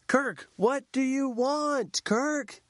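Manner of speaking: high, slow coaching call; facilitator. Retold or imitated. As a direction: coax the answer out slowly in a high voice. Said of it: "facilitator goes".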